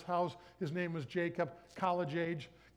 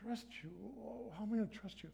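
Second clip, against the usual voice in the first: softer, higher disbelief; incredulous; 3 semitones higher, 8 dB quieter, faster than usual. Retold or imitated. Young him answering God, softly incredulous. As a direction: scoff at the idea.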